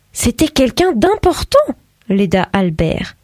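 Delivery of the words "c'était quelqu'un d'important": high, self-important hint; proud father. A narrator's reading. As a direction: puff up a little. Albert hints importantly.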